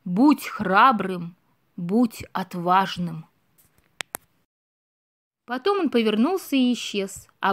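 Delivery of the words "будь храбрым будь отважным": slow, low, grave chief's pronouncement; old stag commanding Bambi to be brave, very slow and low; solemn old chief. Acted. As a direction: draw each word out like an ancient leader. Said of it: "и громко произнёс".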